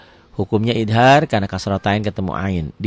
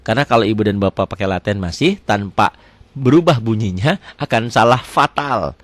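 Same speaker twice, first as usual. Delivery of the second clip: usual speaking voice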